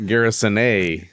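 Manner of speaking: Garrisone ending in "-ay", no S; silent final S. Shown as a demonstration. Joking answer on how to pronounce it, silent-S Garrisone. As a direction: say Garrisone slowly, mock-French "ay" ending. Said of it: "It's a silent S at the end".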